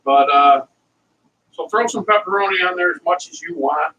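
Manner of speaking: normal talking voice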